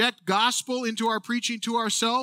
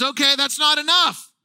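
higher and louder, insisting it's not enough; building excitement; 5 semitones higher, 3 dB louder than usual. Retold or imitated. Preacher voicing David wanting more praise, excited. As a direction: push harder with each line. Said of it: "And he goes".

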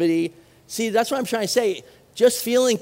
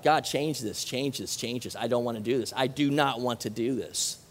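soft, fading plea, quieter each time; anguished pleading; 8 semitones lower, 7 dB quieter than usual. Retold or imitated. Jesus pleading with God in the garden, soft and anguished. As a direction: beg quietly, voice sinking. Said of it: "three times he asked God".

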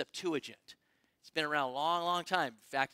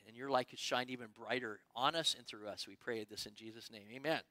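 prayer; 4 semitones lower than usual. Real, not acted. Pastor prays before message.

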